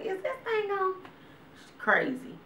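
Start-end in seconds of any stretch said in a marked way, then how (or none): 0.0-0.9 very high softer repeat of the nag; nagging mom. Retold or imitated. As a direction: keep nagging, higher. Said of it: "just constantly".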